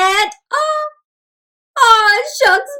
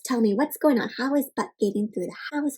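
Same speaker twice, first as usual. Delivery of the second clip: plain reading voice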